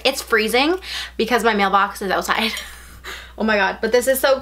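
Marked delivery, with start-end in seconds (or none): none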